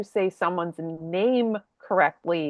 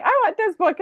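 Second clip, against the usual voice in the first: put-on much higher little-kid voice; three-year-old; 12 semitones higher, 3 dB louder, faster than usual. Three-year-old asking tired parent for a book, imitated child voice. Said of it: "he was like".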